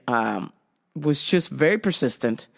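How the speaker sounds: normal talking voice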